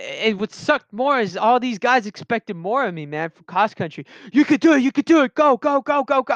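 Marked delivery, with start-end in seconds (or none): 4.3-6.3 high, loud cheering chant; shouted go go go encouragement; cheering teammates. Retold or imitated. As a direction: pump him up like teammates at a race.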